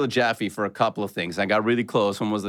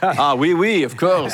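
mock French accent; playful French accent, laughing; 4 semitones higher, 4 dB louder, slower than usual. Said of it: "that French guy you did".

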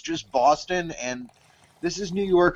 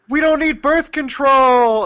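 mock cheer; 10 semitones higher, 6 dB louder than usual. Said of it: "gays finally were just like".

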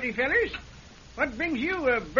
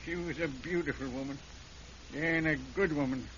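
broken confession; 7 semitones lower, 5 dB quieter than usual. Acted. Caught killer confessing to the sheriff, low and broken. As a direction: give up, each line heavier.

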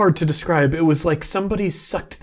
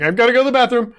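innocent excuse; 8 semitones higher, 5 dB louder, much faster than usual. Pretend. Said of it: "he's just gonna be like".

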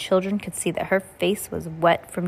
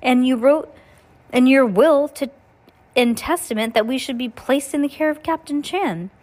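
upset; 6 semitones higher, 4 dB louder than usual. A narrator's reading. Violet upset, accusing.

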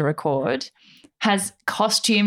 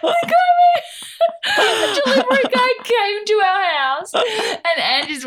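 laughing; 13 semitones higher, 3 dB louder than usual. Real, not acted. Laughing while retelling.

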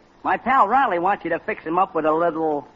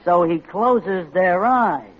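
slow and deliberate, a touch lower; slow veiled threat; 4 semitones lower, much slower than usual. Acted. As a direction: lay out the threat slowly. Veiled threat about Fred.

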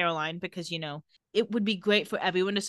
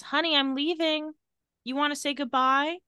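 higher-pitched, lightly voiced mother; sweet mom's farewell; 7 semitones higher than usual. A mother tells her daughter she is leaving, lightly voiced sweet farewell. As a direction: gently imitate a mom calling a goodbye. Voicing a mother saying goodbye.

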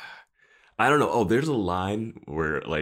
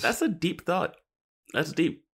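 mock-impressed; 12 semitones higher than usual. Real, not acted. Teasing reaction to analysis.

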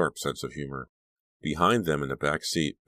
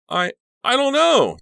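much higher, louder shaken stammer; 10 dB louder than usual. A narrator's reading. Danielle caught with raw meat, stammering in shock. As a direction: stumble over the words, caught and scared. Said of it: "Danielle stammered".

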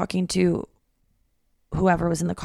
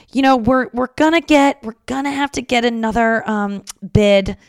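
higher, louder flustered mom voice; imitating apologetic mom; 5 semitones higher, 5 dB louder than usual. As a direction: gush apologies like a nervous mother. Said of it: "She just kept apologizing".

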